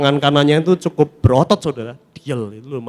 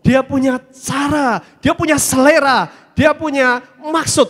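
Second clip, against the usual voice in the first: preaching at full force; 6 semitones higher, slower than usual. Real, not acted. Pastor proclaiming to the congregation, full-force preaching. Preacher stressing God's own way.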